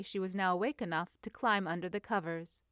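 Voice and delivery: plain reading voice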